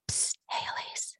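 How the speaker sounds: unvoiced stage whisper "Psst, hey, Elise". Friend whispering to Elise outside Ina's building, a hushed whisper. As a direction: hiss it secretly like spotting a celebrity. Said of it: "he said".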